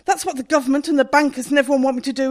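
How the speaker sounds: normal talking voice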